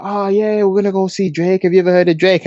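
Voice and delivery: high-pitched quoted chatter. Retold or imitated. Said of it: "They were like".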